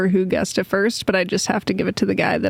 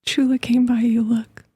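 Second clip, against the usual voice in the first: whisper; 4 semitones higher than usual. Real, not acted. Pointing out dog quietly.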